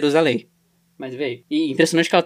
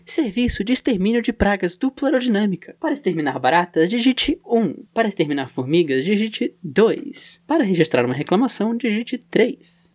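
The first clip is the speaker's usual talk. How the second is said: phone menu voice; 2 semitones higher than usual. Pretend. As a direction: read it like a recorded phone menu. Mock pest control phone menu.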